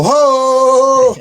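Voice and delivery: high, long drawn-out Ho; signature catchphrase holler. Acted. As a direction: belt out a high, sustained wrestler's holler. Acting out his signature cry in commercial.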